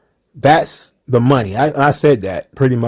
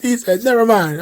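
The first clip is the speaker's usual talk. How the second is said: laughing; 8 semitones higher than usual. Real, not acted.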